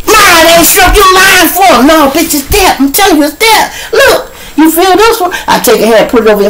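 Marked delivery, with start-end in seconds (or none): 0.1-1.9 high, loud protest; shrieking mock accusation; acted angry accusation. Acted quarrel over missing eyebrow. 1.9-5.3 high-pitched frantic insistence; comic squealed protest; insistent mock reassurance. Acted quarrel over missing eyebrow.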